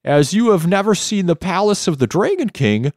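high, wavering jellyfish voice; wobbly floating jellyfish. Acted. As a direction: coax him in a lilting warble. Jellyfish coaxing in story.

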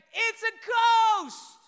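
a high, loud, drawn-out scream of terror; panicked shout. Retold or imitated. Disciples in the boat spotting a figure on the water, terrified high shout. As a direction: yell it like you've just seen a ghost in the storm. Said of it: "The disciples freak out and they're like".

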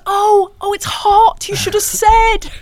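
high, loud squeal of surprise; mock Vader caving. Vader learning the food is hot, hammed-up high squeal. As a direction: suddenly squeal like the villain giving in. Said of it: "And he goes".

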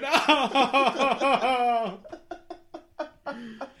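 high hammed-up sobbing wail; cartoonish fake boo-hooing; mock crying. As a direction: imitate someone breaking into bawling. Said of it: "starts crying and starts going".

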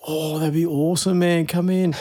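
put-on a stoked kid waving you in; excited schoolie. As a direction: teenager thrilled by free lollies, high and eager. Schoolie voiced accepting frogs.

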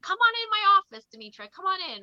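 a hint of a cheerful boss waving her in; imitating welcoming principal. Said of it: "He's like".

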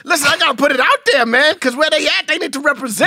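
high, loud hype-man shout-out; mock hype call-out. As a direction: call out the crowd like an MC. Hyping up his fan base.

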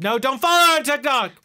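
loud, high-pitched objection; alarmed shout. Real, not acted. Co-host cutting off a TikTok plug, alarmed shout. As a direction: shout over the plug to stop it.

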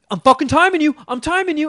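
high, loud mimicked threat; retold yelling. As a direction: imitate a riled parking attendant. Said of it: "he's still, like, yelling shit at me".

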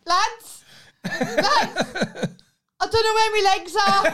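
high, loud laughing panic; panicked call. Retold or imitated. Laughing at herself, calling the lads in panic.

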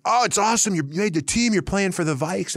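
mock gushing fans. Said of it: "everyone's like".

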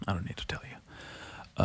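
secretive whispered aside; hushed teasing aside. Real, not acted.

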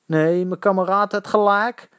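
pushy, high-pitched bullying; threatening. A narrator's reading. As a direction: warn him off aggressively. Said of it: "dreigde een potig uitziende kerel".

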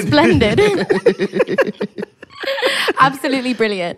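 put-on giggling through a British accent. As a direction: play the delighted British interviewer, laughing. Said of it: "your British accent, like, is good".

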